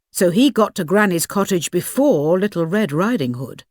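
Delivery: ordinary delivery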